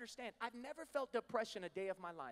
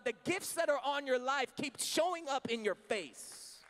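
loud, higher preaching push to the congregation; full-force preaching; 5 semitones higher, 8 dB louder than usual. Acted. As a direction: preach it out, urging them to stop running.

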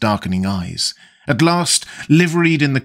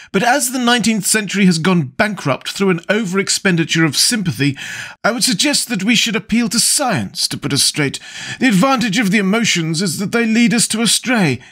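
a hint of a languid aristocrat, higher; lightly voiced witty lord; 8 semitones higher than usual. Lord Henry holding forth to the table, lightly voiced. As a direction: toss off the epigrams with a light aristocratic air.

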